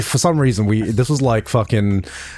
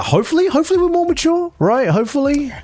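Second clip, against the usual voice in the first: playful doubtful; 13 semitones higher than usual. Real, not acted. Doubting own maturity, jokingly.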